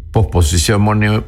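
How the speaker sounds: usual speaking voice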